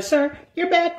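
high, prim gym-lady voice; mock the fussy Karen at the front desk. Gym Karen scolding a member about his bag, in a high prim voice. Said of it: "she like".

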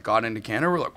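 usual speaking voice

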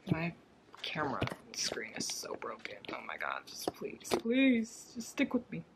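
breathy unvoiced plea, half embarrassed; whisper. Real, not acted. Whispering an apology to viewers about her broken camera screen.

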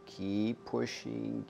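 slow, stretched, soft coaching call; drawn-out coaching. Real, not acted. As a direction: draw out "keep pushing" gently. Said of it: "I'll still coach you to keep blowing".

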